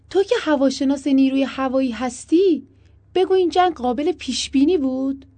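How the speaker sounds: high, loud confronting question; challenging question. A narrator's reading. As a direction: put the question to him loudly, pitched high. Acted pointed question to host.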